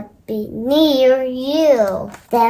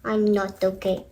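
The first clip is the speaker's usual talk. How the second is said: low, soft and gloomy; mope, say it quietly; sad, quiet; 6 semitones lower, 7 dB quieter than usual. Acted. Gerald miserable, not okay.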